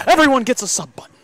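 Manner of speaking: big game show host finale; hammed-up host shouting out the prize to everyone; mock giveaway shout. Giveaway climax.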